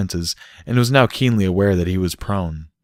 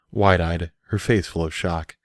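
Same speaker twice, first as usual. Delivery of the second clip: usual speaking voice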